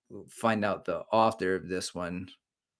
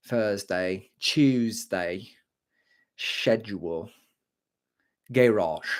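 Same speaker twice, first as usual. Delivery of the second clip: posh British accent on each word; 2 semitones lower, slower than usual. Shown as a demonstration. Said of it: "favorite words to say with a British accent".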